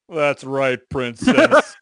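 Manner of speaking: lightly voiced Blake, cracking up; Patrick Warburton voice. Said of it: "What was my voice for Ca-, for Blake?".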